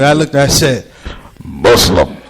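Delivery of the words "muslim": deep scary voice. Retold or imitated. Comedian scaring the nosy lady, deep ominous voice. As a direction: drop the voice an octave to scare. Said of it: "I lowered my voice a couple octaves just to scare her".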